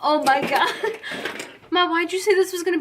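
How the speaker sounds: giggling disbelief; laughing. Real, not acted. Laughing at her messy face.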